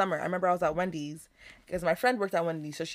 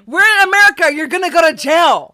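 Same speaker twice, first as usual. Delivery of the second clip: much louder, much higher indignant telling-off; outraged scolding; 8 semitones higher, 14 dB louder than usual. Retold or imitated. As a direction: scold him like he's lost his mind. Retelling telling man off, loud.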